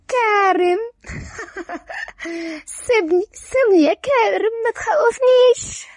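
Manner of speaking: high tipsy voice breaking into giggles; drunk, laughing. Acted. Drunk Lora brushes off angry Karem, laughing. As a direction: laugh drunkenly while telling him to let go. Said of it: "هي بسكر وبتضحك".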